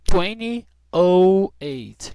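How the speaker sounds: "oh" drawn out loud and slow; slow, loud model. Shown as a demonstration. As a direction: stretch "twenty oh eight" loudly for learners. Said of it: "eu falaria aqui, ó".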